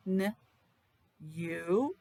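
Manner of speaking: ordinary delivery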